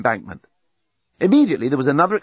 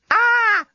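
very high, short scream; high-pitched shriek held on one note; sudden scream; 4 dB louder than usual. A narrator's reading. Lucy suddenly remembering the bomb, screaming. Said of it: "Lucy suddenly screamed".

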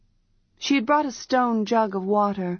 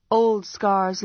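ordinary delivery, nothing marked

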